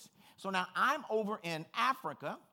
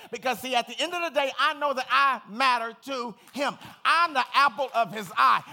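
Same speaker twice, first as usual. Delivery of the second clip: full-force preaching; 3 semitones higher, 12 dB louder than usual. Acted. Pastor preaching to his congregation about storms, loud.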